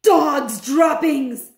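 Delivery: drawn-out loud witch cry; slow loud shout. Acted. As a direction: shout it slowly with disgust. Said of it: "she shouted".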